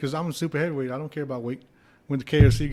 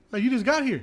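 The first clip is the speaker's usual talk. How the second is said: high, louder disbelief; incredulous; 10 semitones higher, 4 dB louder than usual. Retold or imitated. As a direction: act stunned he already lost it. Retold disbelief at roommate.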